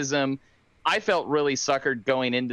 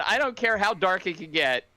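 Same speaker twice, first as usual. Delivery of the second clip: ordinary delivery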